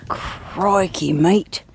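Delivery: hammed-up Australian accent, Crocodile-hunter style; a playful, terrible Australian accent; mock Australian nature-show voice; bad Australian accent. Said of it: "forgive my terrible Australian accent".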